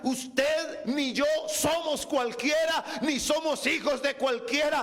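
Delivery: slow, drawn-out preacher's proclamation; fervent preaching. Real, not acted. Preacher telling the congregation their Father is holy, fervent preaching. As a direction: proclaim it forcefully, stretching the words.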